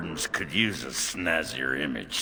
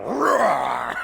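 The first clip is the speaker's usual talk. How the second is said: mock roar; 8 semitones higher, 6 dB louder than usual. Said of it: "you were all".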